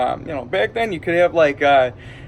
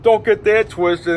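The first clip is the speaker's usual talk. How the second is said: ordinary talk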